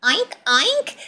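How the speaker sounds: high, loud little oinks; delighted piglet oinking; laughing piglet. A narrator's reading. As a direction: giggle in pig snorts. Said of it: "ríe George".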